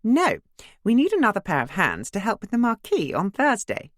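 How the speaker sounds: cheerful well-to-do lady voice; bright posh neighbour. Acted. Imogen's voice.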